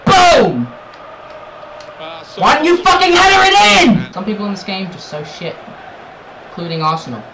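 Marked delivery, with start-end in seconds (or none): 0.0-0.7 high, loud drawn-out boom; explosive cry; triumphant shout. Real, not acted. Shooting at goal. 2.4-4.1 high, loud frustrated scream; furious yell. Real, not acted. Raging at missed chance.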